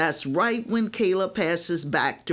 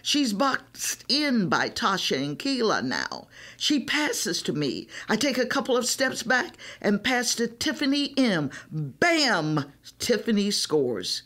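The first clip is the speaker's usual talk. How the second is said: plain reading voice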